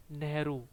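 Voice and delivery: नेहरू with a long ऊ at the end; नेहरू, the final ऊ held long; model word. Shown as a demonstration. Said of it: "नेहरू में आएगा बड़ी ऊ". (नेहरू, बड़ी ऊ के साथ)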